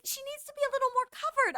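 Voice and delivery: high, quick mock-mom voice; lightly mocking mom's complaint; mimicking mom.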